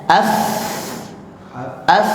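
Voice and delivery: "af" with lots of air out; breathy af. Shown as a demonstration. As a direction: push a long breath through "af". ("af" dengan angin banyak)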